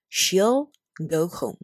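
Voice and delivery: very slow "She'll" with a clear final L; slow model reading. Shown as a demonstration. Teacher drilling the contraction "She'll". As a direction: drill it slowly. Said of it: "就会变成".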